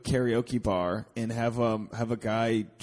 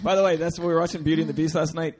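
normal talking voice

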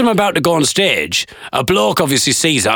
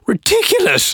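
exasperated disbelief. Real, not acted. Verdict on the absurd singalong.

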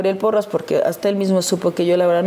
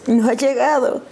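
higher put-on voice for the housekeeper; imitated housekeeper; 7 semitones higher than usual. Housekeeper answering the wife's call about her husband, imitated. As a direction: lightly imitate the housekeeper reporting back. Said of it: "Rufi, que todavía vive con él".

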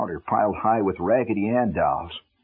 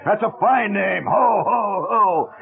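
booming Santa; 8 semitones higher, slower than usual. A narrator's reading. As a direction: boom it out like a department store Santa.

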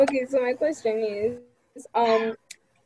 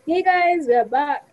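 excited intro greeting; 5 semitones higher, 3 dB louder, much slower than usual. Pretend. Mock show intro greeting.

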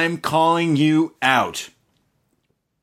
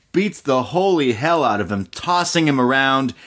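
ordinary talk

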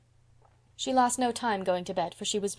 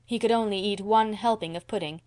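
usual speaking voice

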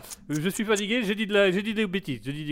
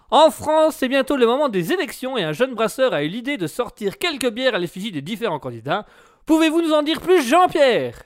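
radio host; 4 semitones higher, 5 dB louder than usual. Acted. Radio host announcing the news.

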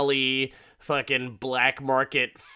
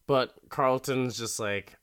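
usual speaking voice

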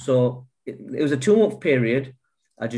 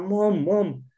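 high, pleading call to someone downstairs; crying out for his mum after reading the message; 7 semitones higher than usual. Retold or imitated. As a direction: call out for your mum from bed. Calling mum from bed.

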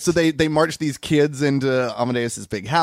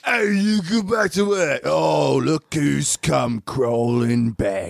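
sneering bully; much slower than usual. Acted. Bully Beefy yells at orphans.

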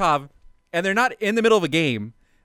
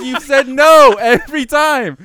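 loud, high, laughing mock anger; hammed-up aggrieved rant; mad complaint; 10 semitones higher, 13 dB louder, slower than usual. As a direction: whine it like a hurt friend left out. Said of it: "so Tommy is mad".

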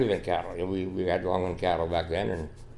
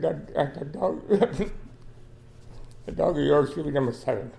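emotional laughter; 5 semitones higher than usual. Real, not acted. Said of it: "now I get so emotional".

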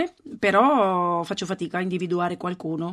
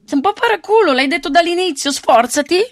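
sgridata forte e acuta, per gioco; rimprovero scherzoso; 9 semitones higher, 9 dB louder than usual. Real, not acted. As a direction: rimprovera l'amico alzando molto la voce. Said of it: "Ma come ti sgrida veramente". (sgrida l'amico che fa il furbo, a voce alta)

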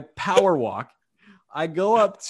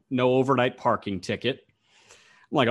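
ordinary talk, nothing marked

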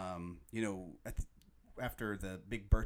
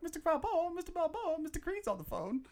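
much higher housemaid calling. Shown as a demonstration. Fan imitating the housemaid calling Rocky, high mock. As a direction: call out like the maid. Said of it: "I'll answer the phone ... be like".

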